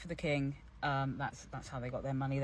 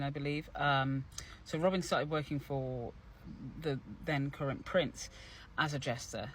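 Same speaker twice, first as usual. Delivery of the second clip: normal talking voice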